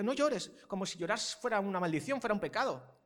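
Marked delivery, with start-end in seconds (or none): 0.0-0.5 put-on higher, louder hushing of a crying kid; mock soothing a child. Parent to a child who fell, mock hushing. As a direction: tell a fallen child to stop crying. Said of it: "un niño se cae y le digo".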